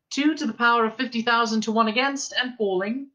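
put-on high, measured announcer-like girl; sensible girl's voice. A narrator's reading. Girl's voice calling out probability odds, sensible. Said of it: "said the voice".

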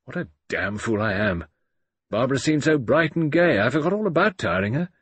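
self-reproach, rising in pitch as it goes; contrite. A narrator's reading. Man sorry he tired Barbara out, contrite. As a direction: kick yourself for your thoughtlessness. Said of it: "Boyd Carrington said contritely".

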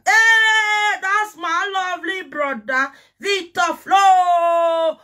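high, loud call, with the name stretched out slowly; loud shout-out. Real, not acted. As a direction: hail your brother across the room, drawing out his name. Calling out to a brother.

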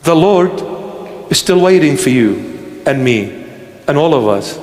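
ordinary talk

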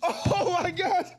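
high, squeaky pleading through laughter; cracking up, begging; helpless laughter. Real, not acted. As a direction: laugh so hard the words come out in a squeal. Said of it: "I'm trying to hold back my laughter".

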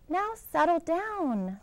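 slow, high, sweet teacher coaxing. Acted. Miss Nelson gently asking her rowdy class, slow and sweet. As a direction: coax the class kindly. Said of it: "said Miss Nelson in a sweet voice".

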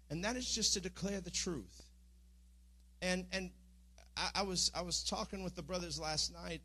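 normal talking voice

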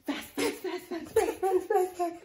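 high, loud rapid chant; fast and excited. Shown as a demonstration. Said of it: "Now do fast".

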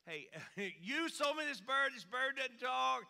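loud high fed-up customer; exasperated complaint. Retold or imitated. Repeat complaint at store.

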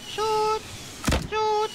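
high urgent call to fire; urgent cry. Real, not acted. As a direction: call it out high, urging a teammate. Urging teammate to shoot mid-fight.

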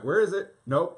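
loud, high cry of annoyance while searching; frustrated eyes voice. Pretend. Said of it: "being like".

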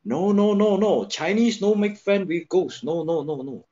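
high, loud string of protests; retold vehement objection, easing off at the end; emphatic refusal. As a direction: refuse outright, bursting in over the suggestion. Acting out his alarmed refusal.

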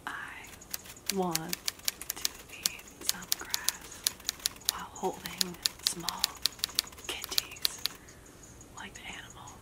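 secret, drawn-out whisper. Real, not acted. Player tells viewers the secret phrase while partner listens to music, whispering. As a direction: let the camera in on it in a hushed whisper.

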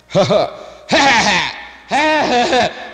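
hammed-up fake laughing, slowing on the last; fake laughs. Shown as a demonstration. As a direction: belt out phony laughter to prove the point. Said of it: "Try to fake four laughs".

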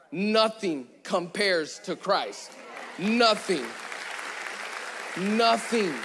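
slow, weighty nothing; emphatic repetition. Acted. Preacher insisting to the congregation, slow and weighty. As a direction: land each nothing hard.

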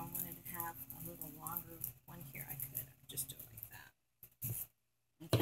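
soft slow whisper. Real, not acted. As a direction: hush it to a whisper. Quiet demo murmur.

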